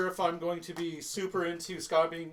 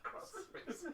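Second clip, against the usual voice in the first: mocking repeat; 10 semitones higher, 13 dB quieter than usual. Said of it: "what do you want".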